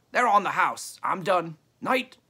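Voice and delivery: loud, high parting shout; calling out. A narrator's reading. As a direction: shout it over and be done. Trader calls off and hurries away.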